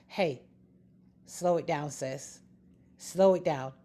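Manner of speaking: low, soft, paced with pauses; calming aside. Real, not acted. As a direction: settle her down, low and gentle. Soothing the listener, slow it down.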